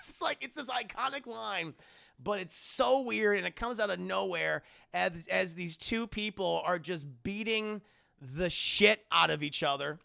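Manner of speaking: ordinary talk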